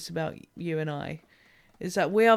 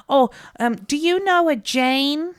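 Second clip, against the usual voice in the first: put-on old lady caller; 6 semitones higher, 8 dB louder than usual.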